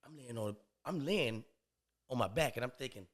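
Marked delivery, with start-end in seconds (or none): none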